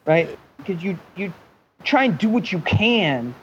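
ordinary talk

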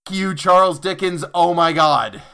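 loud, high-pitched cursing at the author; real frustrated shout; exasperated outburst. Real, not acted. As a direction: yell it in fed-up frustration. Outburst at long Dickensian sentence.

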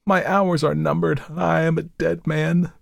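high, weakly pleading lament; tender, doomed. A narrator's reading. Husband believes he is dying, tells his wife tenderly. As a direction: announce your end plaintively, without raising volume. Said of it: "with more tenderness than he had exhibited".

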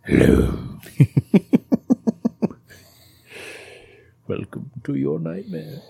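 hammed-up menacing villain; mock evil villain. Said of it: "But now I'm just kind of like".

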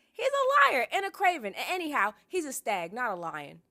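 high, loud sisterly taunt; bratty, scornful outburst easing at the end; Arya. A narrator's reading. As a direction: fling the insults loudly.

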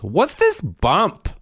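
put-on high, loud, slow child question; dad imitating his daughter's high, slow question; curious little girl. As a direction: ask it wide-eyed like a toddler. Daughter asking, voiced.

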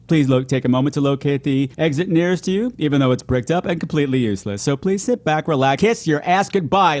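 deep, formal announcement voice; theater announcer. Pretend. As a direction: read it like a cinema PA announcement.

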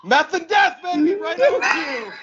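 very high, drawn-out mock excitement; excited squeal. Retelling what he was like in the truck, excited high squeal. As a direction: squeal it way up high, stretching the end.

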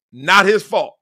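usual speaking voice

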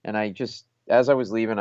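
ordinary talk

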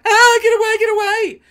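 very high, loud frightened yell; scared driver shouting at her to leave; terrified shout. Retold or imitated. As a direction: shriek them away.

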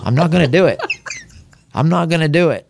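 high-pitched teasing defiance; playful refusal. Retold or imitated. Retells teasing refusal to wife.

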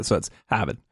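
Harvard mashed fast in a Boston accent; quick Boston Harvard. Shown as a demonstration. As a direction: throw Harvard out of your mouth fast, Boston style. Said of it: "mash it up all together".

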